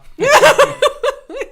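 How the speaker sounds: loud high burst of laughter; big laugh. Real, not acted. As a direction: explode into laughter. Laughing at the caseload joke.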